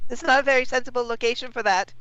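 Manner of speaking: high, far mimicking voice; mocking echo. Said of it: "Are you mocking me?".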